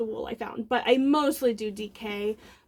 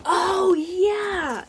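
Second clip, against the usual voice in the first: higher, drawn-out happy cheer; light up as you see what's inside; real excited outburst; delighted recognition; 6 semitones higher than usual. Real, not acted. Delighted on opening her parcel.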